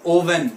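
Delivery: "ओवन" starting with a long O; common oven. Shown as a demonstration. Teacher shows how we normally say it, O onset. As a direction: say OH-ven for "ओवन". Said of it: "हम normally अपने life में".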